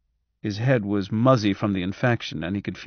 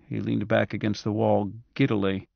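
plain reading voice

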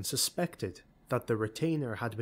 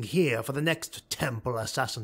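firm, louder, ruthless noble; scheming dark elf lord; 4 semitones higher, 4 dB louder than usual. Acted. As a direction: lay out the plan with cold resolve. Malice rebuts Lunara.

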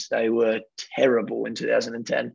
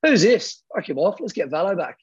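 dismissive shout; 7 semitones higher than usual. Retold or imitated. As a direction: bark it out like a fed-up star. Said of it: "He's just gone".

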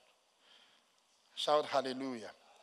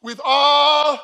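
high, very loud, held last word; preacher's call-out; 8 semitones higher, 19 dB louder than usual. Real, not acted. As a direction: belt it out for the congregation to finish. Calls out verse for congregation to finish.

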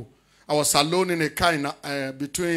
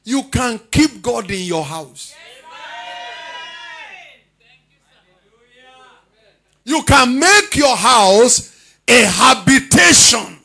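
slow, raised, ringing scripture proclamation; proclamatory preaching; 6 semitones higher, 9 dB louder, much slower than usual. Real, not acted. Preacher reads the translation to his congregation, proclamatory preaching. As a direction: declaim it in big, drawn-out phrases.

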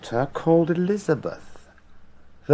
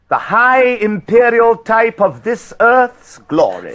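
higher, louder, slow and grand; grand promise; 10 semitones higher, 5 dB louder than usual. Acted. Richard dangles royal glory before the queen, grand and slow.

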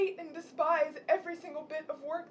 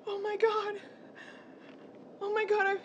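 ordinary talk, nothing marked

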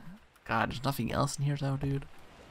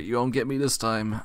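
normal talking voice

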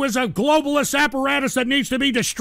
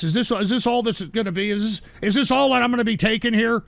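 ordinary delivery

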